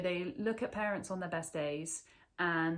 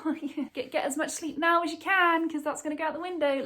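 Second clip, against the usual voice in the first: higher, louder mock know-it-all chirp; imitating breezy advice-givers; 9 semitones higher, 8 dB louder, faster than usual. Everyone advising a pregnant woman about sleep, mocking chirpy advice. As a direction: parrot everyone's advice, pitched up and loud.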